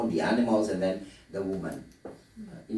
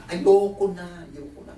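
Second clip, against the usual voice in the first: retold yell; 7 semitones higher, 6 dB louder than usual. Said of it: "dinig na dinig daw yung boses".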